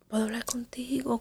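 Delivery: hushed, almost whispered plea; soft whispered request to talk; voice way down low. Retold or imitated. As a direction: ask it barely above a whisper, timid. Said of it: "con la voz bien, bien abajo".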